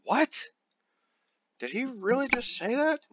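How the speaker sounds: high, incredulous what; mock disbelief. High mock disbelief at his own mangled name. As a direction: act shocked at the name.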